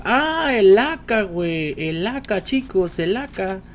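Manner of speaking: high loud thrilled announcement; excited shout. Real, not acted. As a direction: celebrate the find to the chat. Emoción al encontrar el AK. (anuncio emocionado y agudo)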